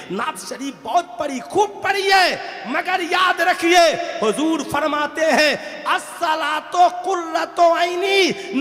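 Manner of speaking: high, slow shouted proclamation; drawn-out, high-pitched preacher's shout; full-force preaching. Real, not acted. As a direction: belt out the sacred saying to the whole crowd.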